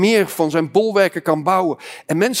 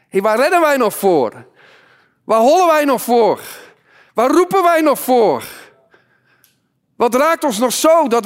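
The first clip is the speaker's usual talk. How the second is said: raised, high-pitched challenge to the congregation; fiery preaching; 5 semitones higher, 3 dB louder than usual. Acted. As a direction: preach with urgent, ringing questions.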